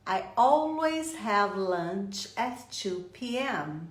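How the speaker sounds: measured, word-by-word English model; slow, careful teaching delivery; slow example sentence. Shown as a demonstration. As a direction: read "I always have lunch" slowly for learners. Teacher reads example sentence slowly.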